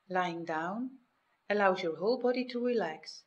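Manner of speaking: ordinary talk, nothing marked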